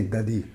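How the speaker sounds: "Daddy" said as "DUH-dee"; Filipino-style "Daddy", first vowel like "duh"; Filipino pronunciation. Shown as a demonstration. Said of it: "We, we say".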